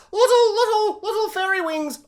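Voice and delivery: put-on high, cooing baby-talk lilt; hammed-up adoring squeal on each little; cutesy delight. Describing fish boy's fairy wings, high and cutesy.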